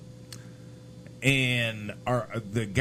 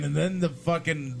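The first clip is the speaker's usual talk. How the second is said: ordinary talk, nothing marked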